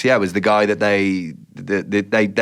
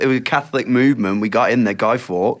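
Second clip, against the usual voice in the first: normal talking voice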